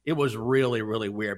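ordinary talk

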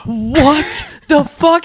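slow, high disbelieving what the fuck; a friend floored by the news, slow high disbelief; stunned friend. Retold or imitated. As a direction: drag it out in shock, voice up high. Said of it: "his friend was just like".

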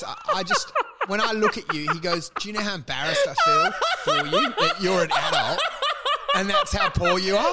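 high, laughing mock boss voice; laughing so hard the quote goes squeaky. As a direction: squeal the boss's words through laughter.

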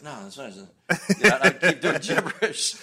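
almost lost in a laugh; laughing through it. Retold or imitated. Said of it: "I'm like".

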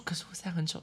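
hushed, whispered protest; soft breath of a whisper; sheepish retold protest. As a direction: whisper it as a shy confession.

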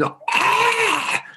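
very high mock shriek; freaked-out scream. Mother who sees the snake, freaked-out scream. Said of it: "a mother who freaks out".

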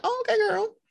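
put-on high, sassy "okay girl"; impressed sass. Impressed reaction to a guest.

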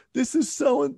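put-on high, upset little-girl voice, borrowed for the retelling; imitating his young daughter. Dad retelling his daughter's words, in a high upset child voice. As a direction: pitch way up like a distressed nine-year-old. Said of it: "She's like".

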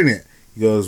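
ordinary delivery

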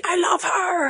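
high-pitched imitation of an ecstatic scream; mock scream. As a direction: mimic someone screaming a love declaration.

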